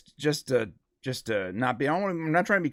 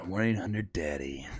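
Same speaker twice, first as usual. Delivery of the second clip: sexy voice; 6 semitones lower, 9 dB quieter than usual. Pretend. Joking along with the hotline bit.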